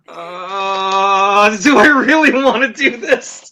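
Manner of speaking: hesitate, then question it half-laughing; comically torn and unwilling; reluctant hesitation. Retold or imitated. Reluctant to sit down in uniform.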